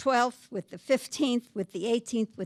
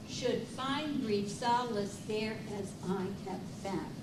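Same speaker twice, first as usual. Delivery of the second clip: soft rereading; 10 dB quieter than usual. Acted. Teacher softly rereads the sonnet's end to the class, hushed. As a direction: read them almost under your breath.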